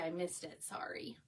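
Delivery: a soft unvoiced whisper of a quick sorry; whispered apology. Real, not acted. Whispering sorry to a viewer she overlooked. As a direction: whisper it like you missed something.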